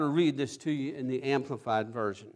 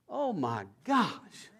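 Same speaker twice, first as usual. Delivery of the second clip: usual speaking voice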